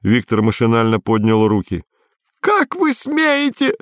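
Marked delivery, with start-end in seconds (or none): 2.4-3.7 very high, drawn-out squeal; high-pitched, stretched indignant protest. A narrator's reading. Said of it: "взвизгнул Эр Квадриго у него за спиной".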